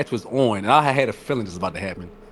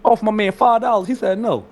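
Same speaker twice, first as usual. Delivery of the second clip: high excited retelling; 10 semitones higher than usual. Retold or imitated. Retelling bid to Cypher.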